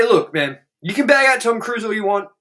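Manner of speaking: ordinary talk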